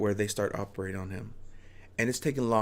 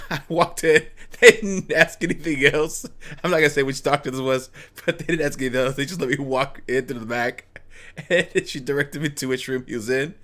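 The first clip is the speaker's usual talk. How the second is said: laughing through the words, louder; 4 semitones higher, 6 dB louder than usual. Real, not acted. Telling a friend how he snuck in, laughing. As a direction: giggle as you tell how easy it was. Laughing while retelling the sneak-in.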